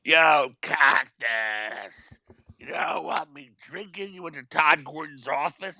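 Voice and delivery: put-on slow, drawn-out drunken-style heckle; Sandman. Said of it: "Takes the mic, goes".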